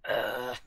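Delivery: loud, low ugh; exasperated groan. Acted. Companion fed up with the anxious host, loud low groan.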